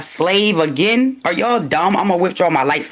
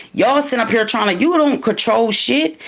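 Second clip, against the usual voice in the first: ordinary talk, nothing marked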